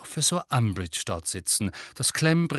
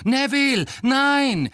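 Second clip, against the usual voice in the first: shouting; 7 dB louder, slower than usual. A narrator's reading. Harry shouts to stop Neville.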